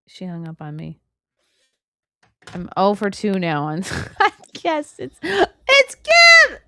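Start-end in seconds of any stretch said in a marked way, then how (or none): 4.2-6.7 very high, loud, laughing announcement; overjoyed as the friend connects; real excited squeal. Real, not acted. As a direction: squeal it slowly through laughter. Friend finally connects, she squeals.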